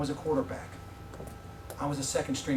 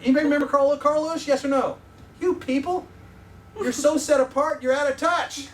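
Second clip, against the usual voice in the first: higher and louder, playfully scolding the crowd; mock exasperated teasing; 9 semitones higher, 7 dB louder than usual. Real, not acted. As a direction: rib the audience for being out of touch, laughing by the end. Teasing audience who don't know.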